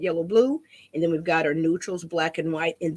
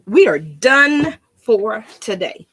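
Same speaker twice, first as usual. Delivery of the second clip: We are done for today, louder and slow, every word spaced out; announcing sign-off; 2 semitones higher, 8 dB louder, slower than usual. Real, not acted. Crafter announces the end of the session to viewers, louder and slow. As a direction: proclaim the wrap-up grandly.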